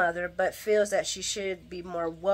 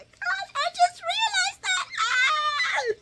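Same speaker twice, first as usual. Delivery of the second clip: excited squeal; much slower than usual. Real, not acted. Sudden excited realization.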